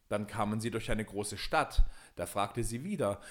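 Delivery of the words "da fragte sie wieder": plain reading voice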